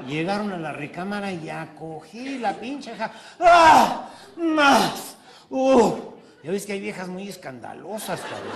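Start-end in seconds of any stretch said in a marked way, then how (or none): 3.4-5.9 high shrieking moans; loud mock moans. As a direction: belt out noisy pleasure cries. Loud woman moaning in joke.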